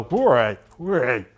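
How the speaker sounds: loud cheer that fades on the second hooray; mock cheer. Cheering the party's dodge, loud then fading. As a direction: cheer it out, then let it drop. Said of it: "and we go".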